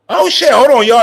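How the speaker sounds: high, loud startled oh; sudden surprise. Real, not acted. Streamer stops mid-sentence to ask chat, startled. Streamer notices screen change.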